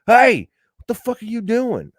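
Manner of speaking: cop shout. Shown as a demonstration. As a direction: yell to jolt a sleeping driver awake. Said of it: "You bang on it".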